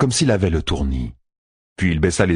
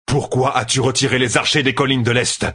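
higher-pitched, pressing interrogation; accusing question; 7 semitones higher than usual. A narrator's reading. Commander asks why archers left the hills, accusingly. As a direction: demand an answer, pitch raised.

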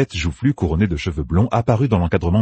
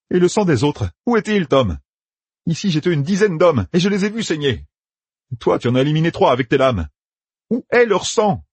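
put-on raised, higher young soldier giving orders; Matt's voice; 11 semitones higher than usual. A narrator's reading. Matt sends Tom and Talmanes off, commanding. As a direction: bark out orders to his companions.

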